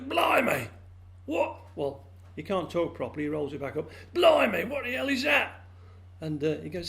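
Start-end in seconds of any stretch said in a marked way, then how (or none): 0.0-1.6 astonished well frog. A narrator's reading. Well frog discovers own tongue. 4.1-5.6 very high, loud frog exclamation; astonished well frog. A narrator's reading. Well frog shocked by tongue.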